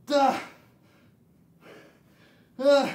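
loud high effort grunts; strained grunts. Real, not acted. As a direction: grunt through the rep.

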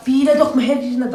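loud, sudden warning; sharp warning. Real, not acted. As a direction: call out to stop someone. Hamur saçılıyor, uyarıyor. (yüksek sesle uyarı)

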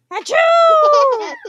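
big loud sneeze, high pitched; huge sneeze. Acted. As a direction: explode into a giant sneeze.

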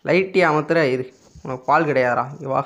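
ordinary delivery

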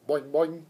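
boing like a frog. Acted. Frog Og agrees with the hamster, loud boing. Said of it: "Og agreed".

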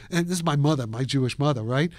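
ordinary talk, nothing marked

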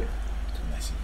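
mock dazed whisper. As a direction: breathe it out, stunned.

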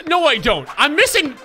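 loud, high exasperated retort; frustrated outburst. Real, not acted. Gamer answering encouragement while missing shots, exasperated. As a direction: snap back loudly in frustration.

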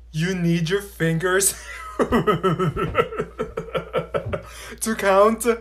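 mocking laugh through words. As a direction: laugh while teasing. Mocking classmate's counting.

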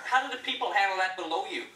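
ordinary delivery

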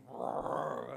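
long, loud imitated lion roar; drawn-out mock roar of an old lion; granddad lion's roar. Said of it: "his granddad's roar".

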